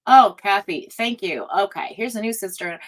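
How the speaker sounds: usual speaking voice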